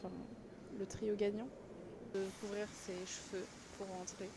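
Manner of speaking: very soft, discreet voice; hushed. Real, not acted. Visitor filming inside a mosque, hushed.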